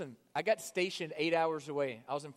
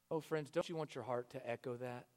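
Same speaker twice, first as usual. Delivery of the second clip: ordinary talk, nothing marked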